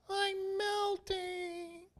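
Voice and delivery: very high, very slow witch wail; Wicked Witch. Retold or imitated. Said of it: "like the Wicked Witch of the West".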